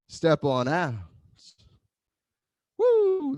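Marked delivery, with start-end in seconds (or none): 2.8-3.4 very high whoop of joy; excited whoop. Real, not acted. Instructor whooping to class after the step, very high. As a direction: let out a high woo. Joyful cry during movement.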